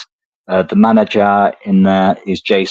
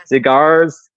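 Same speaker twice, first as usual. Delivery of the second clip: higher-pitched mock American accent; 5 semitones higher, much slower than usual. Shown as a demonstration. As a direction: lean into the American R. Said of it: "American accent".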